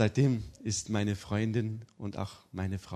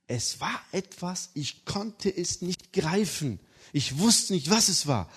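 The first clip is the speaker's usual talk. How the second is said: higher, slow, searching; heartfelt; 8 semitones higher, 4 dB louder than usual. Acted. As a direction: reach for the words earnestly. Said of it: "emotional testimony".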